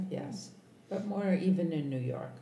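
ordinary delivery

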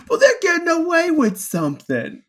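high, loud mocking voice; squeaky voice mocking the masses. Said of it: "and they're like".

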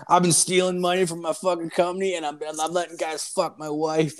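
high-pitched, mock-weepy blurting; imitated crying drunk. Drunk passenger confesses to the young driver, mock crying. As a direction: blurt it out as a tearful drunk, put on. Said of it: "and he's crying".

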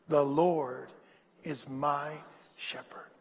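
low, spaced-out phrases fading almost to a whisper; slow recitation. Shown as a demonstration. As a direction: recite it slowly, letting each phrase settle. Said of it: "say that slow".

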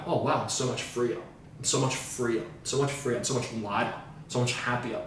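soft, wondering sense of release; quiet relief. Acted. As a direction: let it out softly, as if unburdened. Imagined relief after letting go.

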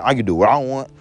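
ordinary talk

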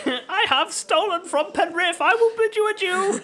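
high goblin squeal; hammed-up storybook creature; thieving hobgoblin. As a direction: proclaim a theatrical farewell as a goblin. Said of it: "bartender was an actual hobgoblin".

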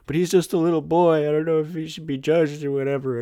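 high and fast, a put-on pleading Mac; mock Mac voice. Quoting Mac's excuse for boy.

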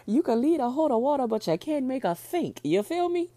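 high, loud comedian quote, hammed up; loud mock stand-up delivery; Cat Williams impression. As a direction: belt it out like a stand-up punchline. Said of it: "I always think of Cat Williams".

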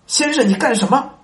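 the slave's shocked cry; greatly startled. Acted. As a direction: jump back startled. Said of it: "他大惊地说".

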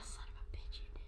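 breathy stage whisper. Real, not acted. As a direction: whisper it under your breath. Whispered aside about choking.

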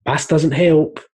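lightly mocking the know-all; smug cashier. Imitating the shop guy who refuses the ID, lightly smug. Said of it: "he's like".

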